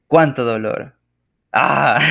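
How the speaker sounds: loud, hammed-up wail of pain; mock cry of pain. Shown as a demonstration. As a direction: act out the hurt, loudly. Reading exclamation example of pain.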